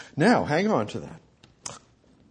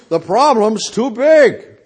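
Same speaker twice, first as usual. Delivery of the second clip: big declaration; 5 semitones higher, 7 dB louder than usual. Retold or imitated. Philip declaring problem too big.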